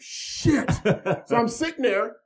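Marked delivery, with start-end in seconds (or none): 0.0-0.7 a breathless whispered shit; whispered curse. Retold or imitated. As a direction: hiss it under your breath. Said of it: "I'm like".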